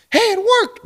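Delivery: very high, loud exclamation; mock triumph. Caller's phone charging at last, mock triumph. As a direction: squeal in pretend delight. Acted tech support success.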